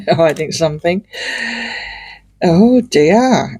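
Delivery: ordinary talk, nothing marked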